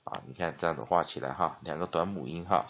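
ordinary delivery

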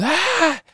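short high-pitched mock scream; quick high "ah" of fright; retold scream. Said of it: "she screams".

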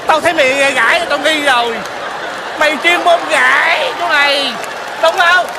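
angry accusation. Acted. Man unmasks neighbour posing as his mother, angry. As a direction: confront the impostor you have caught.

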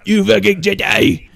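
loud, put-on alien junk dealer voice; grumpy creature snapping back, loudly; Watto. Alien dealer refusing a Jedi's request, indignant character voice.

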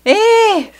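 ordinary delivery

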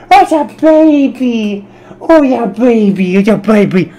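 put-on high, slow baby talk to the cat. Shown as a demonstration. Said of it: "I just had to play with my cat".

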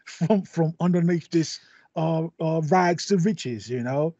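put-on cockney British accent; cockney accent with a scrappy edge. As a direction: play a cockney underdog pulling his family up. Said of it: "you're really on point with that, uh, accent there".

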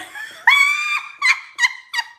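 high, loud burst of laughter; shrieking laugh. Real, not acted. Telling viewers a subscriber has a crush on her, shrieking with laughter. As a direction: crack up in a shrill shriek.

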